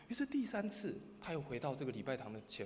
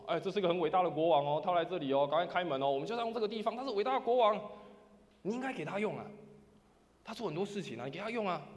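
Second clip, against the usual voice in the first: proud demand; 4 semitones higher, 8 dB louder than usual. Retold or imitated. Proud leader demanding entry.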